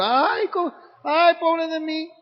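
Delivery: hammed-up poor-me wail; mock self-pity. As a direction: whine like someone sorry for themselves. Mocks self-pitying whiner.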